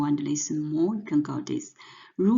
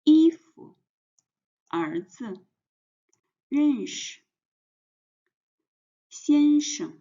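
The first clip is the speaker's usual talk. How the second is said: 衣服, 儿子, 认识, 先生, 朋友, 晚上, 漂亮 each front-stressed with a light second syllable; spaced teacher's drill, neutral tone ending 衣服 through 漂亮; stress on first syllable; 3 dB louder than usual. Shown as a demonstration. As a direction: hit the first syllable of each word, 衣服 to 漂亮, and let the second drop. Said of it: "pronunciation put the stress on the first word".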